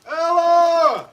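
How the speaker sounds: loud call across the house. Acted. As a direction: call her name out loud. Santa calling out for hidden child.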